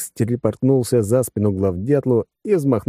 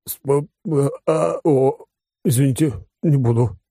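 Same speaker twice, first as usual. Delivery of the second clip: higher, halting broken starts; stammering; 7 semitones higher than usual. A narrator's reading. New commander answering congratulations, stammering nervously. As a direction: stumble over syllables, then apologise. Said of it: "заикаясь, ответил новоявленный командир".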